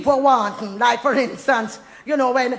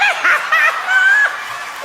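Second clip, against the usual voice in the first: high whooping laugh; 3 dB louder than usual. Real, not acted. Big shout of laughter.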